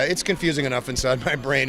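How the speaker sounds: normal talking voice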